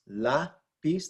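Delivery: lapis split into la, then pis, stress first. Shown as a demonstration. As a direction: sound out lapis by syllable, leaning on la. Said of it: "The inflection's on that la syllable".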